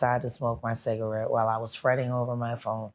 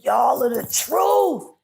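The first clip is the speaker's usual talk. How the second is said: much higher, slow shouted praise; emotional shout; 12 semitones higher, 6 dB louder, slower than usual. Retold or imitated. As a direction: call it out with tearful joy. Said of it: "He said".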